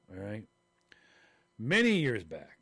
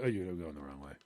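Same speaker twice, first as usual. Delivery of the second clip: muttered scolding; 10 semitones lower, 6 dB quieter than usual. Retold or imitated. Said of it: "I'm like".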